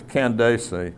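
Candace slowly as can-day-see; English pronunciation. Shown as a demonstration. Teacher settling on the queen's name, Candace slowly as can-day-see. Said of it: "I mean, it's just".

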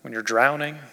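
ordinary talk, nothing marked

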